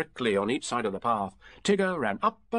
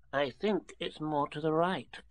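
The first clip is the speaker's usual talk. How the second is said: higher, slow, tentative Piglet; nervous; 4 semitones higher, slower than usual. A narrator's reading. Piglet doubts the way home, nervously. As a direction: offer it slowly and timidly, pitched up. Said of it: "said Piglet nervously".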